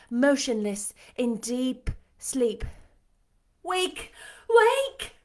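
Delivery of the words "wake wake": high-pitched cry to wake someone; urgent cry. A narrator's reading. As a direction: cry out high, louder on the second. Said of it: "I cried".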